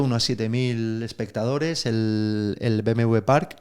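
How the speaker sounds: ordinary talk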